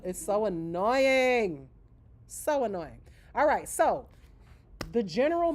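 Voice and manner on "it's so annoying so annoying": exasperated. Real, not acted. Venting about noisy salons.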